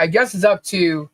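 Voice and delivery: ordinary talk, nothing marked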